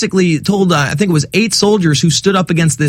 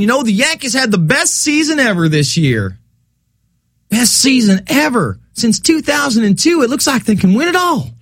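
mock Southern sports fan, rising higher toward the end; Southern accent; 5 semitones higher than usual. Radio host mocking a distracted sports fan, Southern accent. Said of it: "I'm doing it in a Southern accent".

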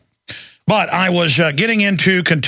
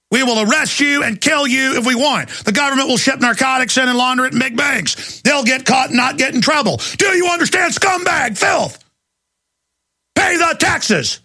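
much higher, shouted sneering threat; shouting mock tyrant; 8 semitones higher than usual. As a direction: bellow it as a gloating tyrant. Voicing tyrannical government.

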